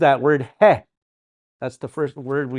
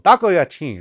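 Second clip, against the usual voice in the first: louder, higher mock phone greeting; blunt phone answer; 4 semitones higher, 7 dB louder than usual. Answering the phone without a hello, blunt and loud. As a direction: pick up and demand it, no hello. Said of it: "as soon as you answer the phone".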